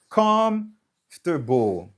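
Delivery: slow comfortable, COMF stretched out; stress on first syllable. Shown as a demonstration. Teacher repeating for Mark, first-syllable stress. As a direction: hold the first syllable of comfortable, then let "ter-bowl" fall. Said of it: "se la w ap plis aksantye".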